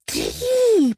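high, loud outraged yell; raging. A narrator's reading. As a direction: keep raging at the discovery. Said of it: "продолжала неистовствовать Пенелопа". (неистово, с визгом)

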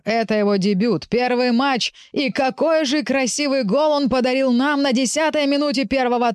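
high, lively stadium announcer; sports commentator. A narrator's reading. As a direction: announce it like live sport on air. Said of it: "вещал комментатор".

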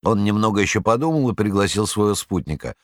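plain reading voice

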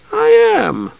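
put-on very high, proud girl's reply; haughty dignity. A narrator's reading. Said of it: "Usha said with haughty dignity".

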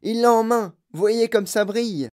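high, loud onlooker's cry; excited calling. A narrator's reading. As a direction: call out in wonder to the crowd.

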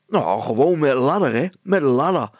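put-on high, loud childish voice; a cheeky little girl piping up; Petronella the girl. Girl answering the mayor, high and childish.

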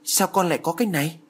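surprised. A narrator's reading. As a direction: ask in open amazement. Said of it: "ngạc nhiên". (ngạc nhiên, giọng cao lên)

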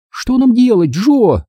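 high, mildly panicked plea; frightened girl. A narrator's reading. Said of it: "услышал я перепуганный голос Минди". (испуганный голос девочки)

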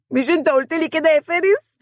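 put-on very high child's appeal; whining little girl. A narrator's reading. Little girl appealing to Faris, high and whining. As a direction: plead like an upset little girl. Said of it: "والتفتت لفارس وهتفت به".

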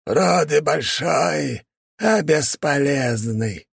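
put-on high, slow, creaky old voice. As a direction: drag it out in a scratchy old voice. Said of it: "скрипучий голос Зорна".

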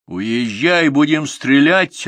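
loud, drawn-out threat, rising high; shouted warning. A narrator's reading. Grandfather refuses the raiders' offer, shouting a warning. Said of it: "ответил Николай".

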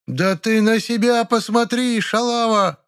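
very high, slow insult; furious shout. A narrator's reading. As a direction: scream the slur. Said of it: "закричала Полина Харитоновна". (яростный крик)

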